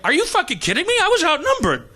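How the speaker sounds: high-pitched, incredulous self-defence; mock indignant protest. Defending himself to his accusers after the fight, mock indignant. Acts indignant reply to critics.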